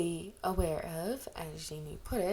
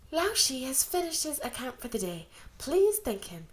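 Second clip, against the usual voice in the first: lightly voiced younger sister, higher and louder; 6 semitones higher, 5 dB louder than usual. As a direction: announce it like a proud host. Younger sister formally closes session.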